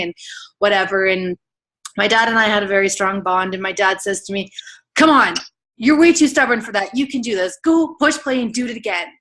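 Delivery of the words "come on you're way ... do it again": higher, punchy tough-love urging; imitating a dad's forceful encouragement; firm pep talk. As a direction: push her on like a no-nonsense dad. Retold dad pushing her on.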